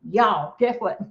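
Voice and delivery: ordinary delivery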